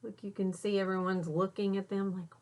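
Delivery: usual speaking voice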